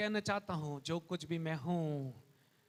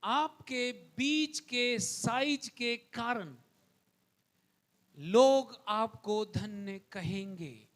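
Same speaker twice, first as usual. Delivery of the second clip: ordinary delivery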